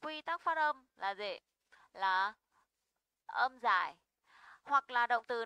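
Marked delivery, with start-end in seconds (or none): none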